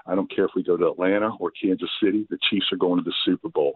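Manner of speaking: ordinary talk, nothing marked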